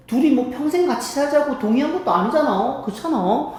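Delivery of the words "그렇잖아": usual speaking voice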